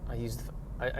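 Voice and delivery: normal talking voice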